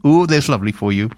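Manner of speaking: lilting Welsh accent; light Welsh lilt on every word. Shown as a demonstration. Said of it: "I always have to do it in a Welsh accent".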